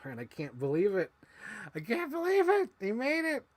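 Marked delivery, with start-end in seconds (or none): 1.7-3.4 much higher, squealing disbelief; excited squeal. Real, not acted. Toy survived a lawnmower, high excited squeal.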